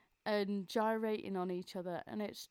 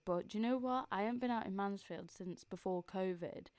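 normal talking voice

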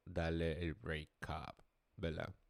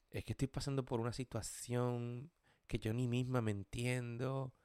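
mocking a woman's excuses; 3 semitones higher than usual. Woman breaking up, quoted.

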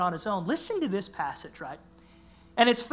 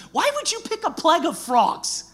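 incredulous quote; 8 semitones higher, 5 dB louder than usual. Retold or imitated. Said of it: "many of us are like".

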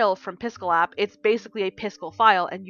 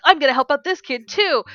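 higher and louder, pumped; fired-up vow; 6 semitones higher, 6 dB louder than usual. Retold or imitated. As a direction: hype yourself up to help another kid. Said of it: "I'm like".

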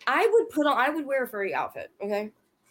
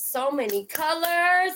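slow, higher, drawn-out so many colors; dreamy delight; 8 semitones higher, 4 dB louder, slower than usual. Retold or imitated. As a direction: savour so many colors slowly.

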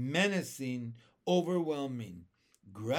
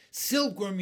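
louder urgent call of a name; yelling; 4 semitones higher, 7 dB louder than usual. A narrator's reading. James yells to the silkworm for help, louder. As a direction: call her over fast, it's urgent. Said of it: "yelled James".

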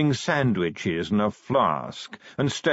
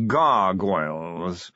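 drawn-out proud announcement; 2 semitones lower, much slower than usual. A narrator's reading. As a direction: stretch the word out with pride. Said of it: "said Sir Reynold proudly".

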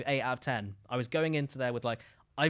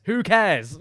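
second Who cares? pushed out much louder; louder insistent Who cares?; 4 semitones higher, 9 dB louder than usual. Shown as a demonstration. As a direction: repeat Who cares? with a loud punch. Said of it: "repeats who cares louder".

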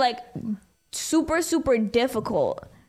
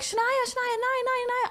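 high, repeated name calling; whiny classmate calling; 11 semitones higher, 4 dB quieter than usual. Retold or imitated. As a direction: nag her name over and over. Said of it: "they're like".

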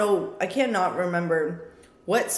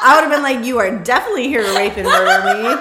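laughing; 5 semitones higher, 12 dB louder than usual. Real, not acted. Laughing at absurd scenario.

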